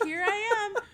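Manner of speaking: high voice breaking into laughter; barely holding back a big laugh; cracking up. Retold or imitated. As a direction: squeal the punchline through giggles. Punchline told while cracking up.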